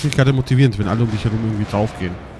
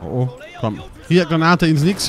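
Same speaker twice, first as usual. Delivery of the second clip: ordinary talk, nothing marked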